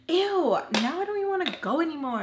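high, quick mock whine; petulant complaint. Acting out own petulant reply to husband.

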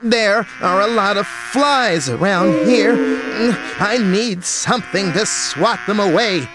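monkey voice. A narrator's reading. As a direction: play the fussy monkey complaining. Monkey voice in the story.

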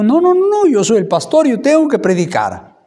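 stubbornly insisting he is the pastor; stubborn insistence. Retold or imitated. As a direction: pastor retelling his stubborn refusal to his wife, loud and high. Insists on preaching despite voice.

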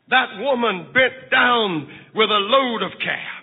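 ordinary talk, nothing marked

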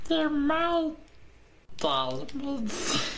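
high, far-off gollum voice; high, possessive creature voice; Smeagol impression. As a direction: grasp at the diamonds like Smeagol. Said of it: "my Smeagol impression".

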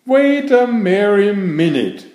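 high elf cry. Acted. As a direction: call out to stop him, pitched high. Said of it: "cried an elf".

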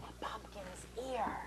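unvoiced whisper. A narrator's reading. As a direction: whisper it into an ear. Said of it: "she whispered".

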